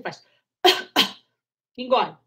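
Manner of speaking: light cough, then swallow; soft cough demo. Shown as a demonstration. Doctor showing a soft cough then swallowing. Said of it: "Em vez de você ficar pigarreando".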